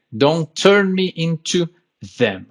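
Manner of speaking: very slow, each word separated, "them" with a clear th; slow careful teacher demo; slow practice. Shown as a demonstration. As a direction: drag it out to get the th right. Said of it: "é mais fácil nesse caso falar mais devagar".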